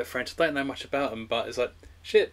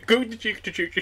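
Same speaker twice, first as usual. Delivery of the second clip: mock Swedish accent; 8 semitones higher than usual. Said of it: "He's not even Swedish".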